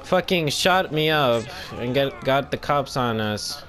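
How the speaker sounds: ordinary delivery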